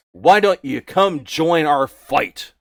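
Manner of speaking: loud, slow rallying invitation; gruff orc recruiter. Acted. Orc guard inviting Jenkin to join the fight, slow and loud. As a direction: draw him into the war band.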